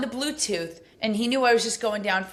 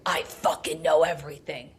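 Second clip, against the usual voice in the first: menacing whisper, low and breathless; nasty devil whisper; 6 semitones lower than usual. Retold or imitated. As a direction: hiss it like a devil coming out. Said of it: "real, like, nasty, like that devil came out".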